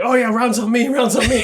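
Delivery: lightly voiced generous boss; jovial drunk DM. Imitating the DM offering to buy rounds.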